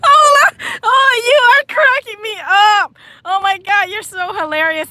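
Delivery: high, loud laugh-talk; words squeezed through laughter; real laughing while speaking; laughing hard. Real, not acted.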